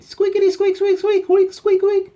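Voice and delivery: very high, loud toy squeaks; squeaky toy imitation. Dog chewing its toy, high piercing squeaks.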